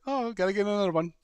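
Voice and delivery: mock owner shrugging off the loss; breezy mock owner. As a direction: toss it off like a shallow owner. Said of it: "she's gonna be like".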